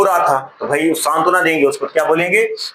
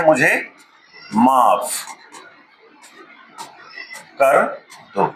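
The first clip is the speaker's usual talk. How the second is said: "मुझे माफ कर दो" with long pauses between words; slow spaced demo; 3 semitones lower, slower than usual. Shown as a demonstration. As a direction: drop each word in slowly, one at a time. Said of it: "क्या बोलते हैं?". ("मुझे माफ कर दो" रुक-रुक कर, हर शब्द अलग)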